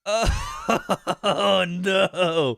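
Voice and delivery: breathy laugh into high, loud oh no; nervous laughing dread. Real, not acted. Streamer spooked by the game, laughing nervously. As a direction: laugh it off while scared, pitch climbing.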